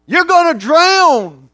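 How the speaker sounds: high, loud and slow, drawn-out alarm; grandmother's warning. Retold or imitated. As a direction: cry out the warning in a panic. Grandmother panicking at beach.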